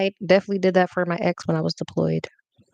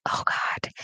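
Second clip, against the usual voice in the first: whisper; 6 dB quieter than usual. Retold or imitated. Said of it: "So I'm like".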